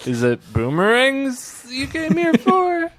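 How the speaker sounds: high, drawn-out silly voice; exaggerated high-pitched quote; imitated comic character. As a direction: squeal the question slowly in mock wonder. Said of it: "He's like".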